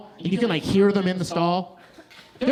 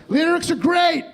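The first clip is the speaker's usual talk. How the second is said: hype shout; 9 semitones higher, 5 dB louder than usual. Acted. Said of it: "This is the part where I solo".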